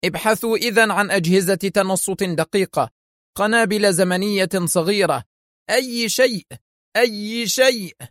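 high-pitched, urgent command; exclaimed order. A narrator's reading. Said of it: "هتف به ديليشمسكي".

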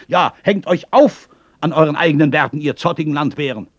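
put-on loud, high-pitched drunken scolding; angry shouted rant. Thrown-out drunk rants at the inn, angry shouting. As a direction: rail at them at the top of your voice. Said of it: "mit solcher Geschwindigkeit gegen das Haus loszuschimpfen".

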